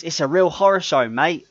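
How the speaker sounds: imitating an English accent, lightly; playful mock English accent. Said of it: "that's how he said it".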